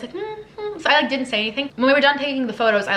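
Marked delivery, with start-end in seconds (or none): 0.1-0.8 high, hushed nervous hums. Retold or imitated. Asked by the singer why she is in New York, shy high hums. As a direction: hum shyly instead of answering. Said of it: "I was doing, like, this".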